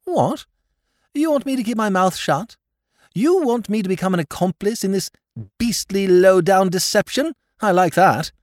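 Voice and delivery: high indignant protest; young aristocrat scandalised by his uncle; outraged. Acted. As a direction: bristle with affronted outrage. Nephew outraged at uncle's request.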